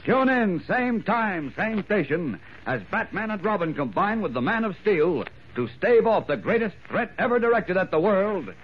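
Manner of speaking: high, excited teaser voice; old radio announcer. Acted. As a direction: build hype for the next episode.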